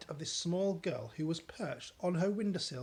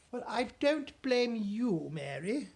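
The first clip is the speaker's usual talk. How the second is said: kindly Queen; 7 semitones higher, 4 dB louder than usual. Acted. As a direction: comfort the maid. Queen kind.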